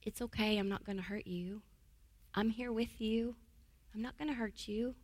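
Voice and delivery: calm a frightened puppy softly; quiet soothing words to a scared dog. Shown as a demonstration. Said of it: "I would lower my voice and I would speak calmly".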